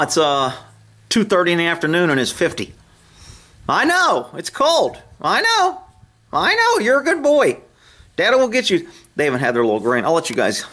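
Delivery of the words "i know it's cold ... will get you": put-on very high, slow sing-song baby voice for the horse; pet baby talk.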